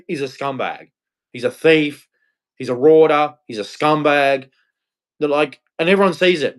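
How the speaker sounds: ordinary talk, nothing marked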